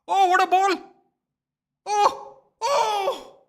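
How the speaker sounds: high, loud yell at a near chance; fan's stunned roar at a great pass; excited shout. Real, not acted. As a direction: erupt as the ball flies in. Near miss during live match.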